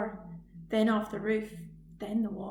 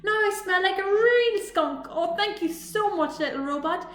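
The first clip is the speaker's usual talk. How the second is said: high, louder gushing gratitude; overjoyed thanks; 8 semitones higher, 6 dB louder than usual. Acted. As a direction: pour out thanks.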